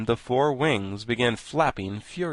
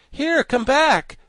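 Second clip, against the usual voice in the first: slow, very high, loud frightened call; frightened cry; 8 dB louder, slower than usual. A narrator's reading. Tip clings to the chimney as the Thing flies off, frightened cry. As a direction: call out in alarm, stretching the words. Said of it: "cried Tip in a frightened voice".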